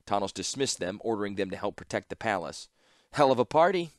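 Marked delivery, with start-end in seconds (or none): 3.1-3.9 higher, louder lightly voiced sardonic woman; wry Ashnod. Ashnod greeting Tawnos amid the siege, wry and lightly voiced. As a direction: toss off a dry quip.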